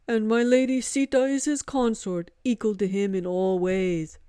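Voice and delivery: loud, solemn Hanuman proclaiming; reverent monkey god. Acted. Monkey god reverently naming Vishnu, loud and solemn. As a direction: proclaim it like a sacred title.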